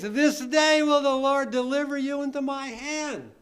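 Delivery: declaimed scripture. Real, not acted. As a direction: proclaim it with fearless conviction. Reads David's challenge from scripture.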